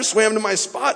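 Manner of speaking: usual speaking voice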